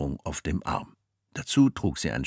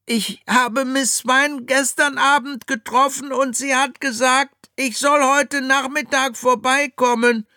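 put-on boy voice; 7 dB louder than usual. Jack speaks.

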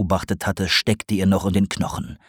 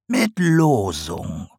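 sly slow reveal; much slower than usual. A narrator's reading. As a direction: savour "Losung" slowly as the potter. Sarg answers slowly.

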